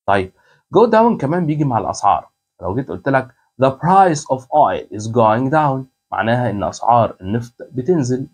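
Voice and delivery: normal talking voice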